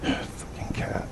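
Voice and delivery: breathy sigh, then a hushed curse under the breath; exasperated whisper. Real, not acted. Speaker woken at dawn by his cat, whispered curse. Cursing the cat that woke him.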